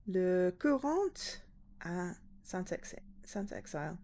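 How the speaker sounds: slow, careful French accent; French pronunciation. Shown as a demonstration. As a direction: read it slowly the French way. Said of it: "I prefer pronouncing it, like, in a French way".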